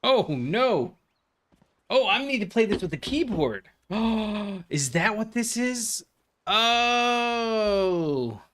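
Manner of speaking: high, drawn-out "oh" of realization; dismayed surprise. Real, not acted. Player discovering the game needs a keyboard, dismayed surprise. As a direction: let the discovery dawn on you with alarm.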